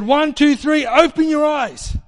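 retold healing command, pitched high and forceful; imitated healer's command. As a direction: count up loud, stretch out the command. Acts out healer's loud command.

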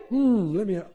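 a hint of staged delight at finding a nearby tutor; mock surprised discovery. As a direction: act as if you just stumbled on it. Feigns finding a match.

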